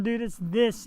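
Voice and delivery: usual speaking voice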